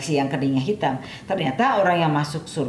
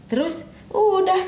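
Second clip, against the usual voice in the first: much higher and slow, closing his story; high slow voiced gardener; slower than usual. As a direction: draw it out high and unhurried. Gardener ends his account. (suara tinggi dan lambat)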